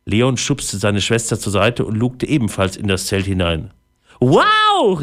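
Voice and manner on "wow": high, loud amazed shout; amazed wow. A narrator's reading. Leon sees the fairy, loud amazed wow. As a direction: burst out in wonder. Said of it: "rief er".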